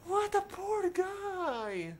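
high, drawn-out pitying wail. Real, not acted. Player lamenting Toot's fate, high drawn-out pitying wail. Pities character stuck as mummy.

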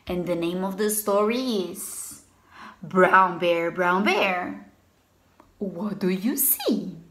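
low, rhythmic storybook chant; sing-song refrain for little kids; reading to children. Acted.

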